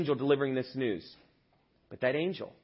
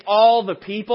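loud prompt; 5 semitones higher, 12 dB louder than usual. Shown as a demonstration. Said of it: "Can you guys say".

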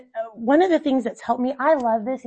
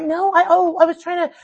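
flustered mom; 8 semitones higher, 7 dB louder than usual. Retold or imitated. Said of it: "And I'm like".

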